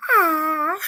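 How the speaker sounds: high, loud aww into a laugh; touched aww. Real, not acted. Reacting to a viewer named last, touched aww. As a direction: coo then laugh.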